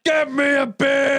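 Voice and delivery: high, drawn-out holler imitating a bossy husband; exaggerated shout, stretching every word; mock yelled order. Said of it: "And he's like".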